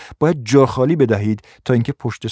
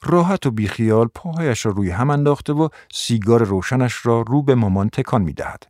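plain reading voice

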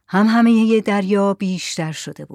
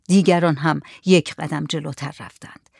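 usual speaking voice

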